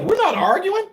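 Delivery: high, baffled protest; a hint of mock disbelief to the wife; mock incredulous reply. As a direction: act amazed she thinks it's a fight. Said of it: "we look at her like".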